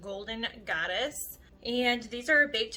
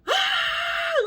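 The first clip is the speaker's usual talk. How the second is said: loud gasp; 8 dB louder than usual. Retold or imitated. Reliving the spatula breakdown.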